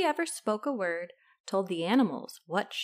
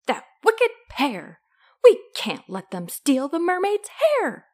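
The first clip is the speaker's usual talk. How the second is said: loud, rising outrage; indignant storybook fish; indignant declaration; 4 semitones higher, 8 dB louder than usual. A narrator's reading. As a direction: declare it to rally everyone. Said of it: "and the fish declared".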